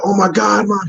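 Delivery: high-pitched, overjoyed gushing; elated son. Acted. Son getting sneakers from his mother, acted elation. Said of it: "He's so elated and happy".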